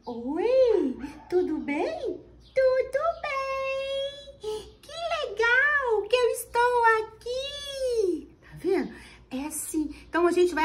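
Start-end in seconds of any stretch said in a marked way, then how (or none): 0.1-2.0 a touch louder, brighter teacher greeting; teacher's reply voice. Shown as a demonstration. Teacher answering her puppet in a mild cheerful voice. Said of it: "Por exemplo". 2.5-8.4 put-on very high, very slow child-like doll voice with a laugh; puppet voice. Shown as a demonstration. Doll answering the teacher, high, slow and giggly. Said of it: "quando o boneco vai conversar".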